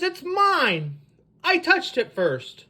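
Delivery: high pitch falling hard on each claim; possessive squabbling. Acted. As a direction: argue like a possessive goose. Quarrel over who found egg.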